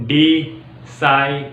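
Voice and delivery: डिसाइड split into two slow parts, di then saaid; broken word. Shown as a demonstration. Teacher breaking डिसाइड into pieces for stammerers. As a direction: break डिसाइड apart slowly. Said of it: "वहाँ पे आप डिसाइड को break कर दो".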